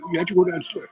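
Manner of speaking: mock order. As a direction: push it up as his nervous order.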